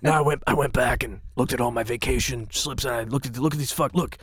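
low, soft, dry scolding; quiet deadpan boss voice; [name]'s impression. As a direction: lay out the evidence in a quiet deadpan. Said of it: "He goes".

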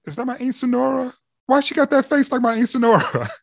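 high, quick mock double-take; startled recognition. Host recognizing his aunt in the look, high quick mock surprise. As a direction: squint and squeal about the auntie look. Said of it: "I'm like going".

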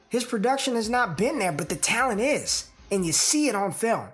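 upbeat pitch to listeners; promo read. Acted. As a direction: sell the show with energy. Promo pitch over music.

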